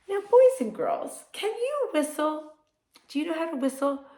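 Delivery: put-on high bright voice to children; cheerful high host asking kids; kids show host. Teacher addresses kids brightly.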